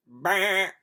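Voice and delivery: loud bleating baa; sheep baa. Acted. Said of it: "said the sheep".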